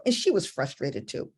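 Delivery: ordinary talk, nothing marked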